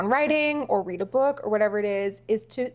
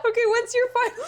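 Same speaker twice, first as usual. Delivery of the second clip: high, laughing through the words; giggling while asking; 3 dB louder than usual. Real, not acted. Laughing mid question.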